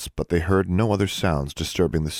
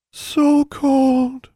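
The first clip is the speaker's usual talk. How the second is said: high, eerie plant voice, louder; a cold dying plant moaning; whispered; 6 dB louder than usual. Acted. Another corn plant whispers of the cold. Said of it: "whispered another voice".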